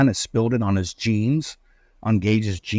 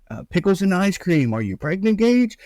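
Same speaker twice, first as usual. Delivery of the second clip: teasing; 8 semitones higher than usual. Retold or imitated. Teasing quote of Adam.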